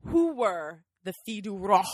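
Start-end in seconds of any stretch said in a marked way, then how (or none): 1.3-1.8 FILLES DU ROI with a snort on Roi; snorted French R. Shown as a demonstration. Asking cohost the topic, snorted R in Roi. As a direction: snort the R in "Roi" for want of rolling it. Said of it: "I'm gonna keep doing that".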